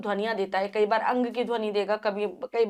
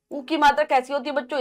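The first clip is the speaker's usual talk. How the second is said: ordinary talk, nothing marked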